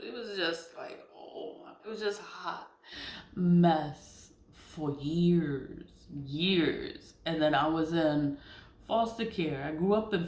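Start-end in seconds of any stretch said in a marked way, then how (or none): 3.3-7.1 mess stretched long and loud; drawn out. Real, not acted. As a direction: lean on mess, slow and heavy. Slow drawn-out emphasis on hot mess.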